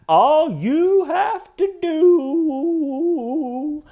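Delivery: very high, very slow falsetto; mock high-pitched drawn-out voice. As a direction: stretch it out way up high. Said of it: "he goes into that voice".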